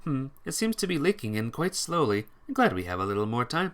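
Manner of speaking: ordinary talk, nothing marked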